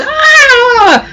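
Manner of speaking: long, very high mock scream; drawn-out squeal of being caught out; startled scream. Emmy caught sneaking in by her teacher, startled squeal.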